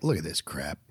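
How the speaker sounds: low, soft grumble of a disapproving dad; grumbling dad. Retold or imitated. Son imitating his father scoffing at the junk, low grumble. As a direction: mutter it like a dad judging the clutter. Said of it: "my dad comes over to my house now and is like".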